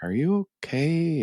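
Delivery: man retells a flight attendant asking if he is okay, in a mild concerned voice. Retold or imitated. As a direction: lightly imitate a flight attendant checking on a passenger. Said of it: "flight attendant came over and was like".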